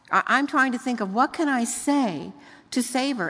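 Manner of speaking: usual speaking voice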